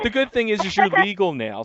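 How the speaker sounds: high, loud and excited; a big excited lift. Real, not acted. Host jokes excitedly.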